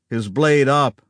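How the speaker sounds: usual speaking voice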